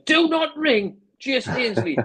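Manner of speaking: high, slow, emphatic warning; urgent order. Retold or imitated. Chairman orders the manager not to sign the striker, slow and emphatic. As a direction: spell out each word like a firm order.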